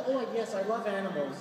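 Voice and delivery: a lightly voiced Long Island woman gushing; the woman in fur tells the friar, in a light Long Island accent. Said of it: "That's a Long Island accent".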